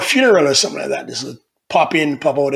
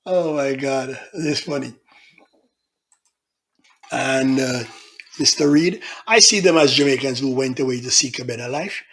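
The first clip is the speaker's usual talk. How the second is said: ordinary talk, nothing marked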